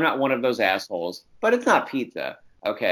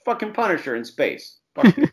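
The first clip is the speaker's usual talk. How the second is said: mock grumbled curse; 9 semitones higher than usual. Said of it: "He just goes".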